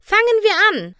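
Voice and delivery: high, loud rallying shout; calling out. A narrator's reading. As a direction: shout to get everyone started. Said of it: "rief Applejack".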